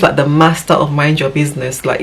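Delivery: usual speaking voice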